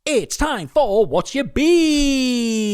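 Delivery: sing-song segment intro; announcer jingle. Acted.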